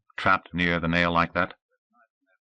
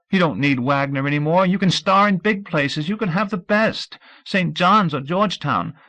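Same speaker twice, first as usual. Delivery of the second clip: a higher voice, pleading through tears; tearful pleading; 13 semitones higher, 6 dB louder than usual. Acted. Audrey pleads with Scout through tears. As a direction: beg him not to give up his dream, crying. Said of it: "The tears were harder now".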